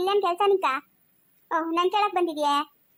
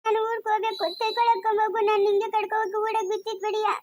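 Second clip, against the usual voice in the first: higher-pitched tearful begging; crying; 3 semitones higher than usual. Acted. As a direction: beg to go home through tears. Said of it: "ಯಾಕೆ ಅಳ್ತಾ ಇರೋದು ನೀನು". (ಅಳುತ್ತಾ ಅಂಗಲಾಚುವುದು)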